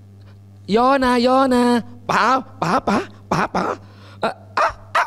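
put-on very slow, drawn-out baby talk; baby talk teaching. Parent coaxes a baby to speak, very slowly. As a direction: coax a baby to say its first words.

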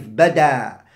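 bada ending in a long open aa; with alif. Shown as a demonstration. Teacher showing the second way of saying it, with alif. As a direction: say bada with a drawn-out final alif, no glottal stop. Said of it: "you can say it with an alif".

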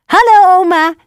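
put-on little girl cheerfully greeting grandma. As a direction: sing out a happy hello. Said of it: "zei Roodkapje opgewekt".

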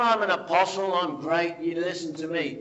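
loud, puffed-up boast of an apostle; mock proud preacher; boastful apostle. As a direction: swagger like a self-important apostle.

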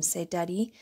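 plain reading voice

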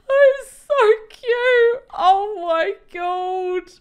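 high, loud, slow coo of delight; gushing. Real, not acted. Builder cooing over her amethyst tree, high and loud. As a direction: swoon over how cute it is.

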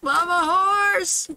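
very high, slow call. Real, not acted. Streamer searching for her horse again, high drawn-out call. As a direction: stretch the name out high.

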